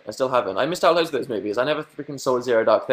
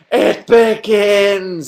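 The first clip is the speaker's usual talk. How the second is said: much higher, louder, very slow proclamation; dramatic doom; 10 semitones higher, 10 dB louder, much slower than usual. Retold or imitated. Seeing the first spider of the year, epic doom proclamation. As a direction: announce it like an epic movie trailer. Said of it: "and I was like".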